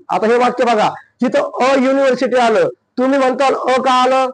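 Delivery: normal talking voice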